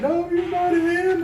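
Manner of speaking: high, slow, distraught mother's cry; a panicked mother refusing to believe it; mom freaking out. Retold or imitated. Son on the phone imitating his mom in disbelief, high and slow. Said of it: "And she's freaking out".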